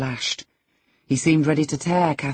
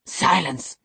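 sharp, higher order; angry exclamation; exclaimed command; 4 semitones higher than usual. A narrator's reading. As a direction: cut them off with a command. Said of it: "he exclaimed".

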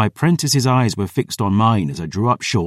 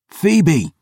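shouting; 8 semitones higher, 3 dB louder than usual. A narrator's reading. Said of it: "I shouted".

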